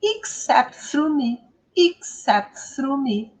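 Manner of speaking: slow "Except through me", stressed on cept, long u in "through"; teacher's careful slow phrase "Except through me"; pronunciation demo. Shown as a demonstration. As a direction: model "Except through me" slowly, vowels of "through me" held. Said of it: "Olha aqui, ó".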